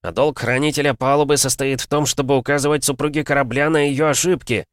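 defiant shouting; shouted retort. A narrator's reading. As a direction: answer the captain back over the storm. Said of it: "крикнул он".

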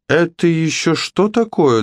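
high-pitched calling question; startled cry. A narrator's reading. Someone below spots the giant cat, crying out. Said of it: "донёсся до них крик снизу".

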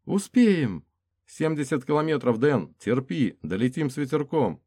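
high, loud urgent cheering on; urgent encouragement. Acted. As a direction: push him to hold on. Urging wounded man to hold on.

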